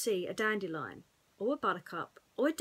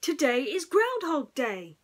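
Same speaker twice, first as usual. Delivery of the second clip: excited announcement; 6 semitones higher, 7 dB louder than usual. A narrator's reading.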